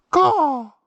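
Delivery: loud, high caw; crow call. Shown as a demonstration. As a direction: throw a caw back at the crow. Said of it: "my crow call works".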